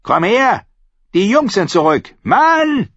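high, loud bossy yelling; impatient wife shouting through the house. A narrator's reading. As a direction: holler for your husband to come. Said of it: "schrie sie laut".

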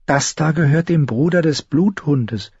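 a hint of an eager little sister explaining; Sansa. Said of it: "hatte Sansa ihr erklärt".

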